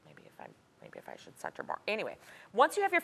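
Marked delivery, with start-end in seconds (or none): 0.1-1.8 unvoiced mutter under her breath; whispered to herself. Real, not acted. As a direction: whisper it to yourself while working. Muttering to herself while gluing.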